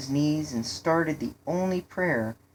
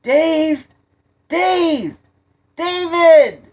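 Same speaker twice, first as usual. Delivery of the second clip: high, loud name-calling, growing louder; shouting over music; 12 dB louder, much slower than usual. A narrator's reading. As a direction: holler for a lost friend. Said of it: "Ron shouted over the music".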